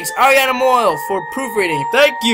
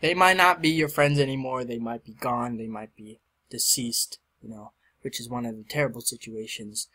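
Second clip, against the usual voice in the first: sincere; 8 semitones lower, 7 dB quieter than usual. Real, not acted. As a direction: speak it gently from the heart. Sincere dedication message.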